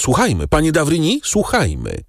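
quick, high call that drops at the end; young man's urgent exclamation; eager call. A narrator's reading. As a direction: urge the others to listen. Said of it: "zawołał młodzieniec".

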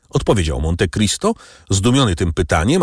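usual speaking voice

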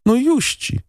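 put-on high child voice answering the lady; boy's voice. A narrator's reading. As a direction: voice the shy village boy, high. Boy voiced, much higher.

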